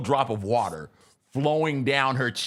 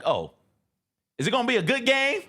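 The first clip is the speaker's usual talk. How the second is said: mock wife question; 10 semitones higher than usual. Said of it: "She's like".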